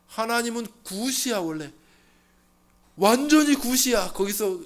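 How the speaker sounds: loud, high proclamation; full-force preaching. Acted. Pastor proclaiming God's gifts to the congregation, loud. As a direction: preach it out to the back row.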